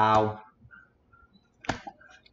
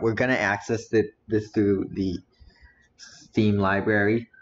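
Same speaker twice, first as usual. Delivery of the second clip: ordinary talk, nothing marked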